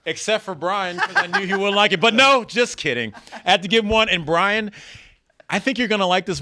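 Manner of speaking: laughing tease. Real, not acted. Teasing Brian while handing out hats.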